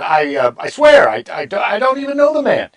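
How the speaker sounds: high, loud, stammering protest; flustered denial. Acted. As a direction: swear it in a hammed-up panic. Acts Peter's flustered denial.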